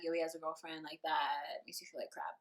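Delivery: soft, low deflated whisper; whispered sadly. Real, not acted. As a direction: whisper it to yourself. Sad aside.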